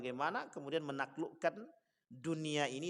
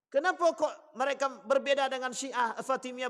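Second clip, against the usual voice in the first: high, raised sermon voice; forceful preaching; 7 semitones higher, 4 dB louder than usual. Real, not acted. Lecturer warning congregation about sects, forceful preaching. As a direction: drive each point home, pitched high.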